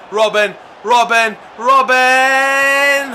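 rising, loud calls of the name; excited shouting. Real, not acted. Gamer urging his winger on, excited shouting. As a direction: cheer him on down the wing.

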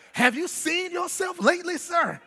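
high, loud, half-sung whoop over the organ; preaching at full force. Acted. Preacher whooping the painter's question to the congregation.

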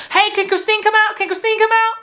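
high, loud sing-song call; kids calling up. Retold or imitated. Friend at the buzzer asking for Christine, imitated high yell. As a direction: holler up for a friend to come play. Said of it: "my buzzer would always ring".

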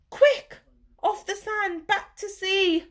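high, loud urgent call; the snail crying out urgently to the whale. Acted. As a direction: cry out to get the whale moving. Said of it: "cried the snail".

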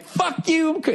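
high squealed brush-off; indignant high-pitched fuck-off; mock outraged refusal. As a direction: yelp it, way up in pitch. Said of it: "I'm like".

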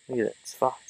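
ordinary talk

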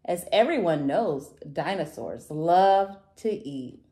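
ordinary delivery

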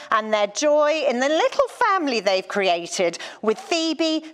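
ordinary talk

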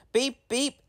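high whistle-like beeps; whistling engine. Acted. Thomas whistling at the tunnel, high and bright. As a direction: sing it like a train whistle. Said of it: "Thomas whistled".

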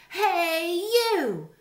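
high, loud squeaky challenge; grouchy ladybug. A narrator's reading. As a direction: pick a fight in a tiny high voice. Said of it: "said the grouchy ladybug".

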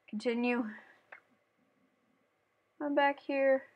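normal talking voice

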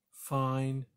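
understated, resigned "fine"; swallowed disappointment. A narrator's reading. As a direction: give in, keeping the hurt inside. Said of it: "swallowing her disappointment".

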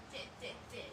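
imitating the baby. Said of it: "เขาพูดนะ".

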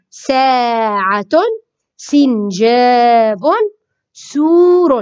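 ساعة، سنجاب، سور, each slow with a long vowel; slow model words. Shown as a demonstration. Teacher reviews the three examples, slow and stretched. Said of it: "إذن هذا وضع الحرف".

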